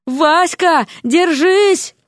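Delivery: high, emphatic yell; acted frantic shriek of abuse; bawling. A narrator's reading. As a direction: holler each word out high and threatening. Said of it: "орала лягушка".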